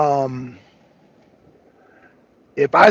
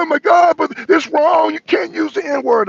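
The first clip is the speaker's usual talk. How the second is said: higher, louder mock outrage; mocking the chat; 10 semitones higher, 5 dB louder than usual. Mocking chat viewers scolding him, high mock outrage. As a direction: play the scandalized chat, pitched up. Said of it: "They were like".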